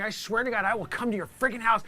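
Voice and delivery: usual speaking voice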